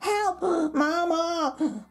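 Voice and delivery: high, long-drawn wail; crying out for help. A narrator's reading. Little colt cries to mama while led away, alarmed. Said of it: "cried the".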